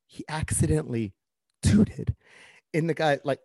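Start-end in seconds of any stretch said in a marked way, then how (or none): none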